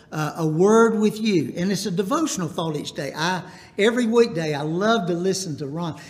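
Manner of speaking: usual speaking voice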